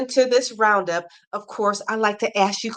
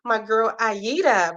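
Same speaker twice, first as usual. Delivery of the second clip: ordinary talk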